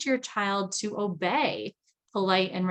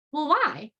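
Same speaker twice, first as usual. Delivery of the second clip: put-on whiny child asking why; 7 semitones higher than usual. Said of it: "Your child goes".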